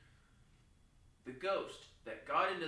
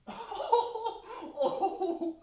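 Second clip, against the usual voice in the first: surprised laugh; 10 dB louder than usual. Real, not acted. Surprised laughing burst in play.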